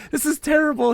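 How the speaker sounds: high-pitched comic wail; mock despair. Player in a storm at sea, mock despair. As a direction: lament it high, hammed-up and amused. Retells fearing death in storm.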